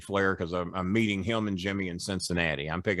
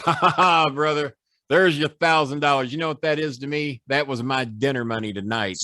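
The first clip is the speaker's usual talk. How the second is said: mocking laugh; 4 semitones higher than usual. Flair sneering while paying the debt, laughing. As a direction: cackle at him, pitched up.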